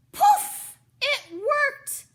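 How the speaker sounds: high loud exclaim; excited cry. Acted. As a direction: burst out with delight. Magic spell succeeds.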